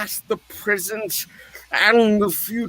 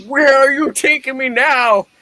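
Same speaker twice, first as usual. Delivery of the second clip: ordinary delivery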